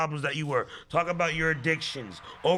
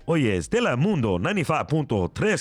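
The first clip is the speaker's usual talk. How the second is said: Spanish-language radio host voice; Spanish TV presenter intro; Telemundo announcer; faster than usual. Acted. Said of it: "be that same guy at Telemundo".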